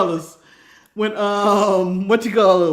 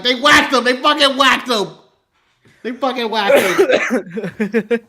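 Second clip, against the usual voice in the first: louder, hammed-up gangster, slowing to a laugh; De Niro grief; 3 semitones higher, 5 dB louder, slower than usual. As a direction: ham up a grieving gangster. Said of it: "he's like".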